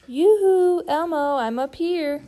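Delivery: high, loud yoo-hoo call down from a tree. Acted. Big Bird calling down from the tree to Elmo, loud sing-song hail. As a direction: hail a friend far below.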